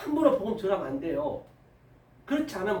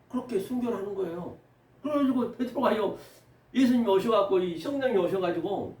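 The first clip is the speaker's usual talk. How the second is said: ordinary delivery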